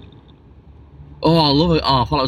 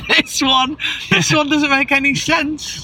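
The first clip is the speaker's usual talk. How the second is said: incredulous; 12 semitones higher, slower than usual. Real, not acted. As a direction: sound baffled and incredulous. Baffled by car comparison.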